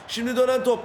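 ordinary delivery

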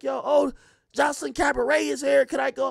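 put-on high, loud, eager girl begging to go; excited girlfriend. Girlfriend in Miami asking to go, high and eager. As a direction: plead like she can't miss it. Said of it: "She's like".

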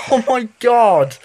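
shaky relieved laugh through the words; nervous laughter. Real, not acted. Gamer recovering from the scare, nervous laughing. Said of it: "when I get scared, I just laugh".

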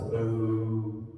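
low, drawn-out moo; normal cow. Shown as a demonstration. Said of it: "normal cow says".

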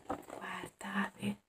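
hushed whisper. Acted. Reader whispers to the children to look, hushed whisper. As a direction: breathe it so the cubs stay asleep.

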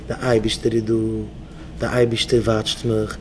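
low, soft affirmation; calm mantra. Shown as a demonstration. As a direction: recite it low and steady, for repeating. Models a mantra to repeat.

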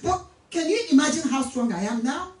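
normal talking voice

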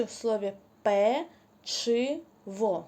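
«печиво» stretched slowly, the «ч» clear; slow, careful pronunciation; drawn-out word. Shown as a demonstration. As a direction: draw out «печиво» for the children. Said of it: "Де ми чуємо звук «ч»".

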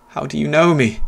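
high, loud panicked plea; frightened question. Acted. Narrator begging the unseen boy, panicked high question. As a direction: ask in rising fear.

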